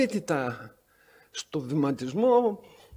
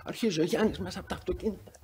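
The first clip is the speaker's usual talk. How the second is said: ordinary delivery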